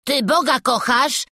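put-on high, loud, indignant boy; incredulous lad's shout; Wawrzuś yelling. A narrator's reading. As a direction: shriek the question in outrage. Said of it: "wrzasnął Wawrzuś".

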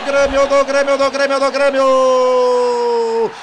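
long stretched goal cry; explosive goal commentary. Real, not acted. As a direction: hold the goal call out loud.

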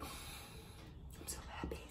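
tiny, slow, voiceless squeal of delight; happy whisper. Acted. Vlogger voices a thrilled product user, in a happy whisper. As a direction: whisper it slowly, as a mock-overjoyed customer.

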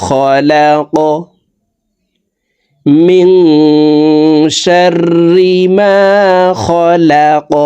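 high, slow chant with qalqala on খলাক; Quranic recitation. Shown as a demonstration. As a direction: stretch and bounce the final ক. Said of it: "ধাক্কা দিয়ে সাকিন করতে হবে". (উঁচু সুরে কলকলা সহ তিলাওয়াত)

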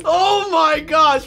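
high, slow, loud laughing gasps; half laughing, half wailing; shaken laughter. Real, not acted. Player reeling from the gate, slow laughing wail.

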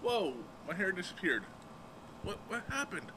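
normal talking voice

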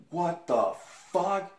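high, stunned disbelief; shocked what the fuck. Retold or imitated. Shocked reaction to couple in theater.

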